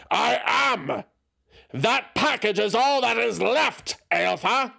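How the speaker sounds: high, loud angry shout; angry outburst. A narrator's reading. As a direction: let the anger burst out. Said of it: "A flash of anger finally erupted".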